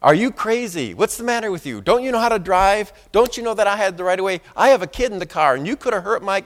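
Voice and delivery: mock-scold him like an upset mother; retold angry yelling. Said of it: "she was screaming at me".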